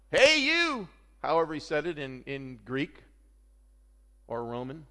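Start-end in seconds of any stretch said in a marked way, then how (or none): 0.1-0.9 high, loud call to stop someone; shouting. Acted. Paul calling to the jailer about to kill himself, shouting. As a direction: yell it urgently across a prison. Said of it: "He shouted".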